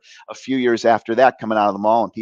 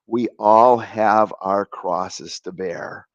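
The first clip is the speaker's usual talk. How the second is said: slow, lower priestly pronouncement; solemn priest; 4 semitones lower, much slower than usual. Retold or imitated. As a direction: intone it piously, half speed. Voicing the priest's pious reply.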